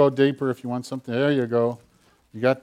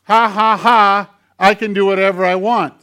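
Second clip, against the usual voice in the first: louder, higher mock laugh, then taunting; naughty puppet crowing in triumph; gloating puppet; 6 semitones higher, 8 dB louder than usual. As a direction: cackle at the puppeteer. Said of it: "nasty little hand".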